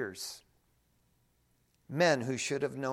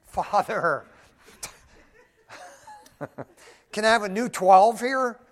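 loud, exasperated appeal to heaven; exasperated plea; 5 semitones higher, 9 dB louder than usual. Retold or imitated. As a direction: beg for a new twelve, fed up. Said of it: "Jesus is going".

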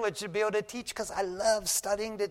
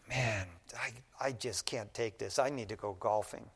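low, quiet put-upon mumble; weary grumble; 7 semitones lower, 9 dB quieter than usual. Retold or imitated. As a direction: imitate a worn-out pastor, soft and low. Mimics worn-out pastors retreating from work.

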